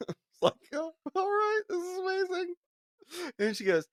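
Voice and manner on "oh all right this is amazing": delighted. Retold or imitated. Teller's delighted reaction.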